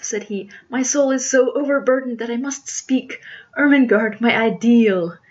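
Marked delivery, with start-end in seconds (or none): 0.7-4.2 higher, louder melodramatic lover; ardent suitor. Acted. Jack declares his love to Ermengarde, ardently. 4.2-5.2 "ideal" drawn out loud by the suitor; "ideal" mispronounced. Acted. Suitor calls his love "ideal", oddly pronounced. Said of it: "he pronounced it ideal".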